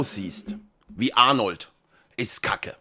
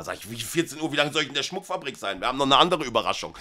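normal talking voice

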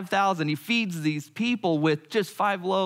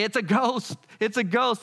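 higher, panicky repeated cry; mock terrified cry; 6 semitones higher than usual. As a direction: squeal it like grown men scared of a ghost. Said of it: "They say".

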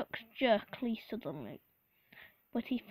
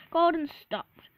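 ordinary delivery

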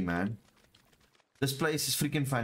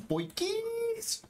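excited. Real, not acted. Excited about loot.

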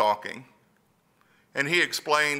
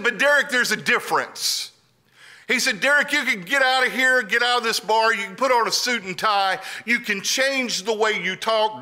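raised pitch, insistent retold counsel; lightly acted, high-pitched urging; urgent advice; 8 semitones higher, 4 dB louder than usual. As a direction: press the point like an older friend. Older friend urging him in retold talk.

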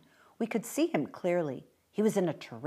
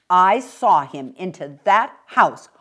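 firm mother; 10 dB louder, slower than usual. Acted. Mother insisting.

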